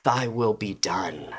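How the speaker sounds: soft, faint dying words; weak, breathless. Acted. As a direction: barely get the words out. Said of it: "coughing heavily".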